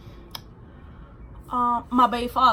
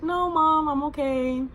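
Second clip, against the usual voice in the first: put-on teen protest; 5 semitones higher, slower than usual. Teen denying to mother.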